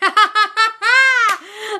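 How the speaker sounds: big high burst of laughter; bright shrieking laugh; loud delighted laugh. Real, not acted.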